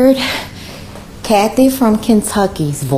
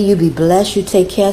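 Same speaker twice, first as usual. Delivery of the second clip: ordinary delivery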